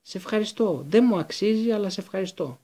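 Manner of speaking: high, effusive gratitude; abject, pleading coward; grovelling thanks. A narrator's reading. Pettigrew effusively thanks Harry, clinging.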